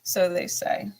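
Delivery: ordinary talk, nothing marked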